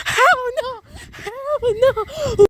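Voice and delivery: high, crying-out refusal; frightened cry. Real, not acted. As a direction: wail it, scared.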